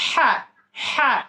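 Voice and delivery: "হ্যাঁ" pushed out from inside the throat; throaty ha. Shown as a demonstration. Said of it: "গলার ভিতর থেকে একটু আওয়াজ বের করা". (গলার ভিতর থেকে আওয়াজ বের করে "হ্যাঁ")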